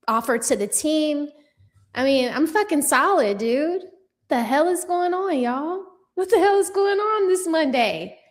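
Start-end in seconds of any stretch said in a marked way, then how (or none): 4.3-8.2 higher exasperated what the hell; exasperated disbelief. Real, not acted. Baffled at the comments this Monday, exasperated. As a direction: throw up your hands at the chat.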